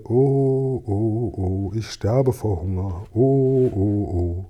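drawn-out moaning "oh"s; wailing. A narrator's reading. Wretched old man alone by his fire, wailing with hunger. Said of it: "schwankte hin und her und jammerte".

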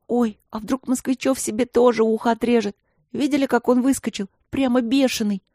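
mildly alarmed schoolgirl; worried girl. A narrator's reading. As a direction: fret about it breathlessly. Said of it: "заволновалась Синицына".

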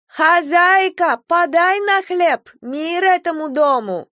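high, loud, slow call from the street; beggar's call. Acted. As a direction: call out to the house for bread. (нищий громко и протяжно зовёт с улицы)

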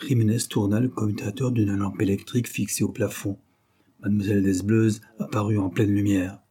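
ordinary delivery, nothing marked

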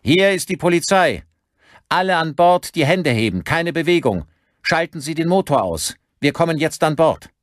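high, loud police announcement; commanding, projected police hail; cold loudspeaker voice. A narrator's reading. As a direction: call out orders as if over a loudspeaker. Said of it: "Aus dem Lautsprecher drang eine kalte Stimme".

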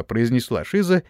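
ordinary delivery, nothing marked

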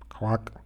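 short, soft duck quack; imitated quack. Someone answering the telephone as a bird, imitated quack. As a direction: give one quiet, clipped duck noise.

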